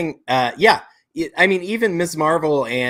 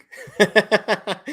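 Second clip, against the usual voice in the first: amused burst of laughter; higher-pitched, real laugh at a funny remark; 7 semitones higher than usual. Real, not acted.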